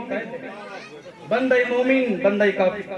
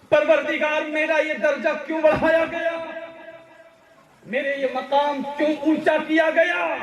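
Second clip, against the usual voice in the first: pleading cry to God; 8 semitones higher than usual. Acted. Dead man asking his Lord why he is raised, crying out. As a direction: call out to the Lord in high, ringing questions.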